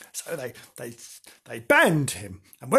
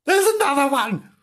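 high, loud scared cry; frightened cry; 10 semitones higher, 4 dB louder than usual. Acted. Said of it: "cried Bernard".

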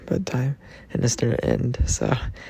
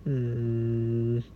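usual speaking voice

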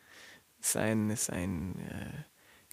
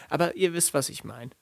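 normal talking voice

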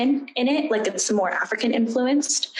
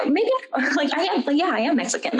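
higher-pitched, laughing self-persuasion; self-convincing; 7 semitones higher than usual. Retold or imitated. Trying to convince herself she is Mexican, mock self-assurance. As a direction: act out talking yourself into it, pitched up.

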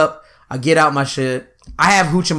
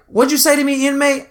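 angry guard; 9 semitones higher than usual. Retold or imitated. Guard challenging him, retold.

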